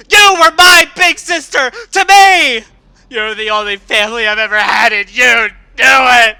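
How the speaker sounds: loud, high tearful shouting; crying and shouting. Acted. Scootaloo screaming at her big sister figure, sobbing shouts. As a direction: scream through tears at someone who betrayed you. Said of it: "Tears were pouring down her face".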